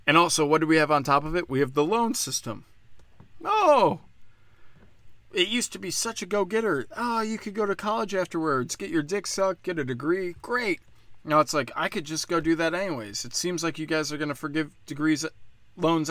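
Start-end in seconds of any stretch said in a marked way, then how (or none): 3.4-10.8 higher, mock-gushing salesman voice; sarcastic sales pitch. Ranter mocks the old college-loan promise with sarcastic cheer. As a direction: oversell it with fake cheer.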